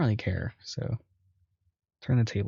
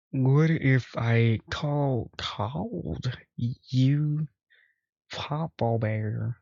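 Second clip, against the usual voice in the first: slow, drawn-out put-on accent for Papa Bear; a mild attempt at Luke's accent; imitating Luke's accent; 3 semitones higher, much slower than usual. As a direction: stretch it out in Luke's accent, teasing. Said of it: "imitate Luke's accent".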